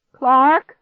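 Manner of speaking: high, loud searching call; shout. A narrator's reading. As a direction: call out for someone missing. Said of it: "I shouted".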